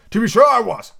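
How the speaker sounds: loud, high, breezy exclamation; gaily. A narrator's reading. Humpty is caught holding book upside down, gaily. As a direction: admit it with blithe cheer. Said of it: "Humpty Dumpty said gaily".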